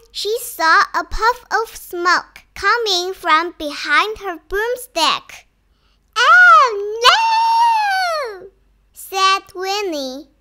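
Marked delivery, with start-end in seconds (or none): 6.2-8.5 high, loud wail of dismay; storybook panic, high and drawn-out; alarmed cry. A narrator's reading. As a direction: cry out in horror, stretching the "no". Winnie sees her broomstick smoking.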